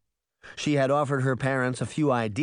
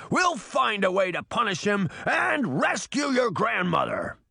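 high, drawn-out roaring vow; fierce roar; 10 semitones higher, slower than usual. A narrator's reading. Titan vows to rescue the grandmother, fierce roar. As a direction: roar it out slowly, swearing vengeance. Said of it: "Titan roared fiercely".

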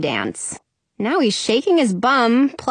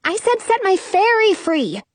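higher, insistent protest; indignant child, pitched up; exclaimed correction; 10 semitones higher than usual. A narrator's reading. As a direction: correct them, stressing "fairy". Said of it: "I exclaim".

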